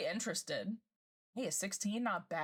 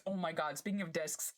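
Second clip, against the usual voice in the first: ordinary talk, nothing marked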